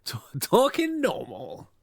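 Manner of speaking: slow teasing imitation of a Scottish accent. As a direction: mimic your partner's Scottish accent. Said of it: "my Scottish accent is slowly coming through".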